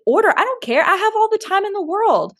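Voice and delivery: high, bright-eyed kindness to a stranger; sweet generous offer. Retold or imitated. To the man at the drive-thru, a high sweet offer.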